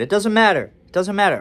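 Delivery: loud, high mock exasperation; hammed-up frustrated retort; impatient outburst. Acting out the angry exchange.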